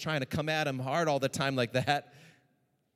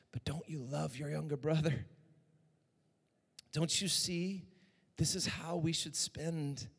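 softer, coaxing fatherly voice; gentle father; 3 semitones lower, 7 dB quieter than usual. Acted. Father pleading softly with his older son, gently. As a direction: reason tenderly with a sulking son.

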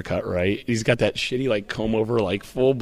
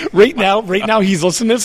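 higher and louder, rising excitement; excited buildup; 8 semitones higher, 6 dB louder than usual. Real, not acted. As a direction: get worked up picturing him. Excited buildup about Jeff listening.

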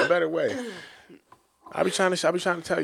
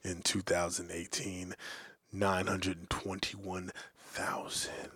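low breathy whisper, much softer; dramatic whisper; 7 semitones lower, 8 dB quieter than usual. Acted. Reading the birth-rate news to cohosts, dramatic whisper. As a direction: whisper it like a grim documentary. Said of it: "I changed my voice for that".

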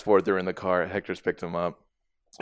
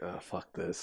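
muttered aside; 3 semitones lower, 13 dB quieter, slower than usual. Retold or imitated. Kids drop act off camera.

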